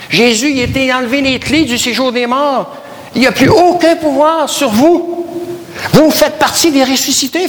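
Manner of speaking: prédication enflammée. Acted. Preacher rising to full force. (prédicateur qui s'enflamme, voix beaucoup plus aiguë)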